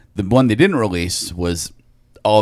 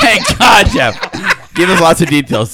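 loud teasing through laughter; mock-grateful, laughing; sarcastic laughing shout; 9 semitones higher, 7 dB louder, slower than usual. Real, not acted. Teasing a long-winded friend.

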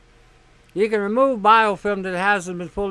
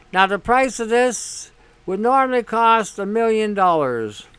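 ordinary talk, nothing marked

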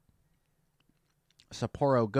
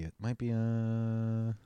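normal talking voice

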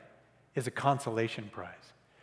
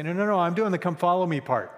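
higher, louder brushing-off protest; busy Christians protesting; 6 semitones higher, 7 dB louder than usual. Retold or imitated. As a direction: insist you're too busy to stop. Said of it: "And they say".

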